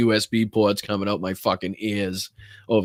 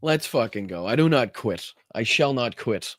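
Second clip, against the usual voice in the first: usual speaking voice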